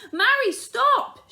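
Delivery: high, loud warning yell; alarmed mother yelling across the field. Acted. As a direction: shout it out to stop her. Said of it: "she yelled".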